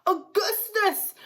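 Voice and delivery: high, slow shout as the mother; a frantic mother calling her son; mother yelling her boy's name. A narrator's reading. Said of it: "shouted Missus Gloop".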